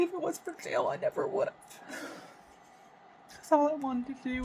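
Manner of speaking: holding back tears about how hard getting clean was; choked-up confession of real pain; close to tears. Real, not acted. Tearful talk of getting clean.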